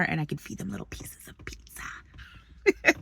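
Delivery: soft whisper so as not to scare them. Real, not acted. Hoping to feed the squirrels without scaring them, whispering. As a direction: whisper it, slowing at the end.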